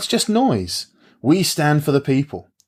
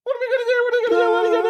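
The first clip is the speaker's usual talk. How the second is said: very high laughing shriek; shrieking panic; much faster than usual. Acted. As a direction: shriek it like a jackpot winner. Hysterical repeated panic.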